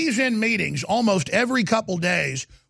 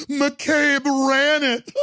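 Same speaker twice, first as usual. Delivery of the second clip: laughing; 8 semitones higher, much slower than usual. Real, not acted. Host bursts out laughing at the news.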